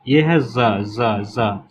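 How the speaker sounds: ज held slow with a buzzing z; ज drawn out as a long buzzing z; z sound. Shown as a demonstration. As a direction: drag out the z in ज. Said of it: "जैसे जिप".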